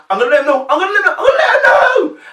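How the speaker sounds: frantic high shout, speeding up; body blurting out. Acted. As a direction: burst out yelling as if you can't hold it in. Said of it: "But the body goes".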